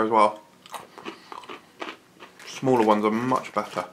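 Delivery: talking while chewing snack; eating between words; mouth full chewing. Real, not acted. Seen 0: holds pretzel, bites it at 4.1, then chews while talking.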